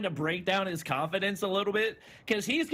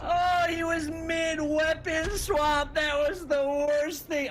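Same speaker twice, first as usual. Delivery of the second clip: high, slow groaning shout; dismayed shout; 10 semitones higher, slower than usual. Real, not acted. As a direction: cry out at the lost round. Co-caster reacts to the loss.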